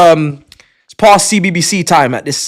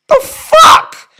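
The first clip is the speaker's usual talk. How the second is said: very high, loud disbelief; shocked squeak; 6 dB louder than usual. Real, not acted. Streamer squeals at twenty gifted memberships, high and loud.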